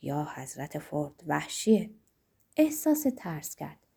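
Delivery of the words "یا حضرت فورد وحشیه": low, soft mutter to oneself; hushed, lowered exclamation; under the breath. A narrator's reading. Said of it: "زیر لب گفت".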